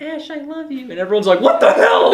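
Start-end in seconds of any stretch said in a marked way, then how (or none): 0.0-0.9 high, soft little creature voice; small, high, tender mascot imitation; Pikachu. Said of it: "Pikachu's like". 1.4-2.1 high, loud outraged yell; imitated crowd outcry, much higher and louder; mock freak-out. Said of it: "freaking out in the theater".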